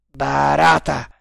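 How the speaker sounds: loud, furious address by name; angry, forceful warning. A narrator's reading. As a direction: say it in fury. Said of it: "gli disse con voce furente".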